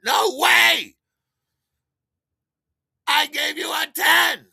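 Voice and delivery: very high, full shouted protest; screaming protest. Retold or imitated. Buyer refusing to be cheated out of ten, screaming. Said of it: "I just start screaming it".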